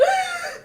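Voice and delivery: high sharp gasp for air; high strangled mock gasp; mock corset gasp. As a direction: gasp as if squeezed tight. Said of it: "could not breathe".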